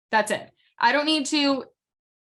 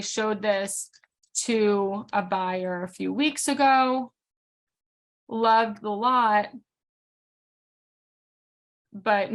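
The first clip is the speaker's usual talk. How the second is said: very slow, with long pauses between phrases; slow dictation; much slower than usual. Shown as a demonstration. Agent writing a comment on a shown house, slow dictation. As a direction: dictate it piece by piece, slowly.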